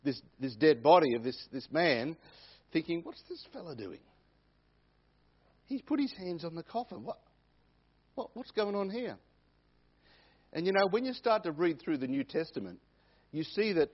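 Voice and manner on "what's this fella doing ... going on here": hushed, lightly acted bewilderment; puzzled bearers' thoughts. Coffin bearers wondering at Jesus touching the coffin, mock puzzlement. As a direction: voice a bystander's baffled thought, softly. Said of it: "I could just imagine those, especially those that were carrying".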